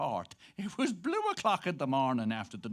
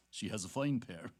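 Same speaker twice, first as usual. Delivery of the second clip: ordinary delivery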